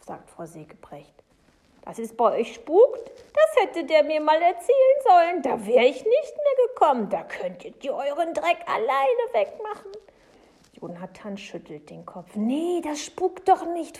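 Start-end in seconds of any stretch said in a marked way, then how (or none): 1.9-9.9 high, loud, indignant outburst; outraged Frau Segebrecht. Acted. 12.4-14.0 high, loud, slow protest; emphatic denial. Acted. Boy protests against ghost idea.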